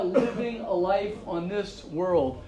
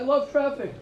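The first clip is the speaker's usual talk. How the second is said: higher-pitched, lightly imitated English accent; 7 semitones higher than usual. Rabbi repeating the positive visitor's line, higher English accent. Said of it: "in his English accent".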